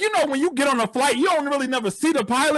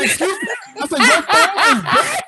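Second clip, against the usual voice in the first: shocked disbelief; 9 semitones higher, 6 dB louder than usual. Retold or imitated. Passenger hears the pilot's phone is dead, shocked. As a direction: let it explode in laughing disbelief.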